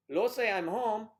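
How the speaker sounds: put-on loud, high Spanish-accented call. Husband announcing he's home, loud Spanish accent. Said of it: "a Spanish accent".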